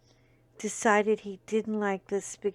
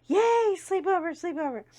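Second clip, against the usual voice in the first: put-on excited kids; 9 semitones higher, 4 dB louder than usual. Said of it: "they were like".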